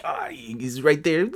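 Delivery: slow, hushed whisper; stalking whisper. Acted. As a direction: whisper it while creeping up on prey. Stalking a mosquito, acted.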